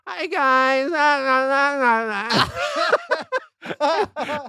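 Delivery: high, droning mock whine; hammed-up obnoxious whining voice; obnoxious whiner. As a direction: imitate a whiny woman, high and stretched out. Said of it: "she's kind of a whiner".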